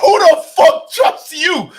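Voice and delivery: high, loud and slow disbelieving yell; outraged shout. Real, not acted. Outraged reply to host's question.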